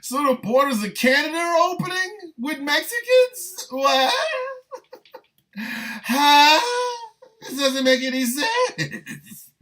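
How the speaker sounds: high-pitched, cracking up in disbelief; incredulous laughter. Real, not acted. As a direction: squeal the questions through helpless laughter. Cracking up at the absurd claim.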